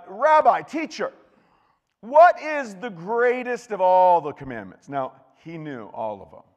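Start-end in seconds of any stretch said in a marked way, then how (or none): 0.1-4.9 loud, high challenge to the rabbi; questioning expert. Acted. Legal expert tests Jesus with a loud, slow question. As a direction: call out the big question, drawn out and slow.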